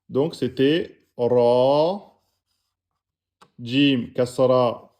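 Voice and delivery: slow spelled-out ra, jim, kasra; teacher spelling slowly; drawn-out syllables. Shown as a demonstration. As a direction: sound out each letter slowly. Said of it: "plus prolongation".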